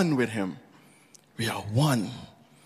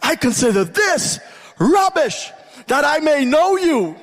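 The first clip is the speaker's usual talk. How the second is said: high, sustained preacher's cry; impassioned pulpit fervour, pitched far up; full-force preaching; 13 semitones higher than usual. Real, not acted. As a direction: build to a soaring, shouted declaration.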